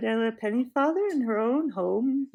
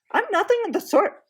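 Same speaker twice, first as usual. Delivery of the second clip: put-on much higher, louder furious girl; indignant girl; 12 semitones higher, 6 dB louder than usual. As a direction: stamp your foot and protest. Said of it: "stomping her foot".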